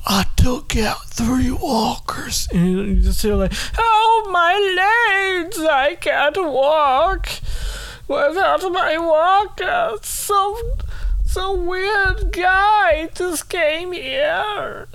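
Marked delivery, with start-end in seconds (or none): 0.1-2.5 slow whispered boast; deadpan whisper. Retold or imitated. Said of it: "Carl's deadpan answer". 3.8-14.9 put-on very high, very slow wailing old woman; wailing granny. Granny complaining a weird guy hurt her, high slow wail. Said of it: "they hit a new note".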